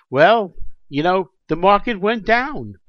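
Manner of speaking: high, loud mocking excuse voice; whiny sing-song official making excuses; mocking officials' excuse. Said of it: "turning around and saying".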